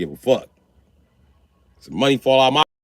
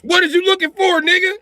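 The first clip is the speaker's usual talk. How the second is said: high, loud startled yell; 4 dB louder than usual. Retold or imitated. Dad waking to son staring, high startled yell. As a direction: yell it, spooked awake. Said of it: "I wake up and dogs be watching me".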